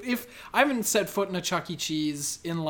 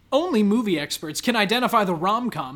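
loud, swooping quiz-title read; headline read; 5 semitones higher, 8 dB louder than usual. Acted. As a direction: sell the clickbait, stretching the final words.

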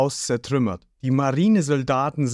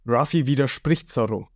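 plain reading voice

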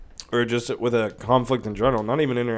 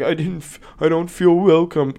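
higher, louder whiny mock sob; mocking crying soldier; 5 semitones higher, 5 dB louder than usual. Mocking a crying Russian soldier, whiny and louder. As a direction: sneer at him as a crybaby, whining. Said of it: "he's crying that the citizens".

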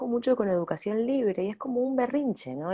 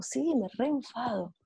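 soft reply; 4 semitones higher, 6 dB quieter, slower than usual. Retold or imitated. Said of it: "Y es como".

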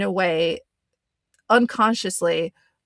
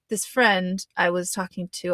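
ordinary talk

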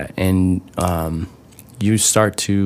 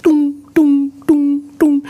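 high, loud mock dong dong; playful imitation of a struck gong; imitated gong bangs; 4 dB louder, much slower than usual. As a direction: bang out the dongs in a high put-on voice. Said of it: "He's like".

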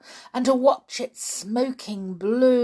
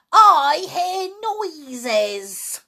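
put-on slow, high old woman screech; screeching grandma; 8 semitones higher, 5 dB louder, much slower than usual. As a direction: drag it out like a suspicious old hag. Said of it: "Granny screeched".

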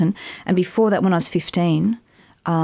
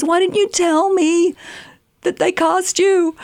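incredulous agent; 13 semitones higher, slower than usual. Retold or imitated. Agent on the phone, baffled she hadn't told him.

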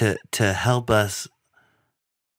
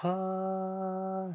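soft, high, sustained angelic "ahh"; hushed celestial tone held steady; heavenly choir note; 10 semitones higher, 11 dB quieter than usual. Retold or imitated. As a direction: sing a long, high, quiet note like sounds from above. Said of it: "those God, sounds come from above".

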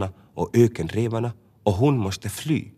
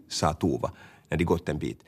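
ordinary delivery

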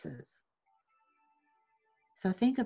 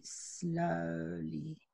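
slowly said much softer, almost trailing into breath; fading hush; 3 semitones lower, 10 dB quieter than usual. Shown as a demonstration. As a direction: let slowly dissolve to a near hush. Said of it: "let it out slowly".

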